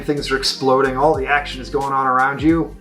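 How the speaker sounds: ordinary talk, nothing marked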